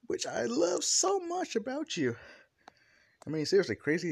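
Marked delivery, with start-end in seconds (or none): 0.1-2.2 very high, sustained gushing voice; gushing affection. Acted. Toga tells Deku she loves his craziness, gushing. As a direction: hold it high and adoring.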